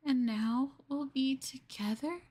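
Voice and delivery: usual speaking voice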